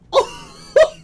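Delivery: very high, loud burst of laughter; overjoyed squeal of laughing; shrieking laugh. Real, not acted. Overjoyed at the pull.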